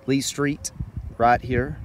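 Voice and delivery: normal talking voice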